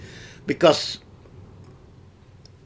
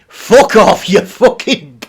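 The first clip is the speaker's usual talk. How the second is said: loud dismissal; 5 dB louder, slower than usual. Real, not acted. Telling off the stalker.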